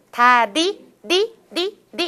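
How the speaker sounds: 다디 with the ㄹ tapped like a quick d; Korean tapping. Shown as a demonstration. Teacher showing Korean to trainees, tapped 디. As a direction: tap once on each 디. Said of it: "우리는 타핑이에요".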